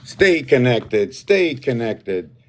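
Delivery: slow, drawn-out words. Real, not acted. Host urging viewers, slow and drawn out. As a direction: stretch each word slowly and deliberately.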